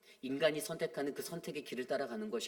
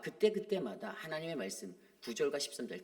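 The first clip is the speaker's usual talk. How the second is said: ordinary delivery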